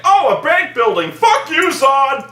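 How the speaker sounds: high, loud hammed-up hero yelling at Zod; mocking a reckless Superman with a loud high shout. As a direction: yell it like a careless brute hero.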